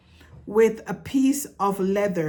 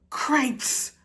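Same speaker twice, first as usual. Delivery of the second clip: higher, sharp cry of alarm; shouted exclamation; 7 semitones higher than usual. A narrator's reading. Startled mouse shouts upon seeing the princess. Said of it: "shouted Furlong again".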